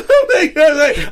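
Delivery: moved to tears, half laughing; overwhelmed gasp. Retold or imitated. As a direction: gush it out through a laugh, stretching every word.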